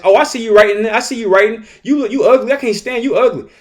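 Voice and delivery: high, teasing schoolkid voice; taunting student. Retold or imitated. A lightly voiced student taunting the teacher, high. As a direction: needle the teacher like a mocking kid. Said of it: "the student ups the ante and says".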